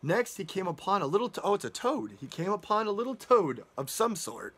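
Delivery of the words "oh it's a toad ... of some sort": ordinary delivery, nothing marked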